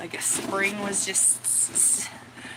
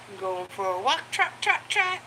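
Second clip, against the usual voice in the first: sing-song trot call. Retold or imitated. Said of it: "So I'll say".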